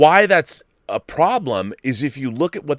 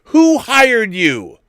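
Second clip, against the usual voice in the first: higher and louder, incredulous rant; exasperated outburst; 8 semitones higher, 5 dB louder than usual. Retold or imitated. Host ranting at the director, outraged and louder. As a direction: demand to know who hired him. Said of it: "And I'm like".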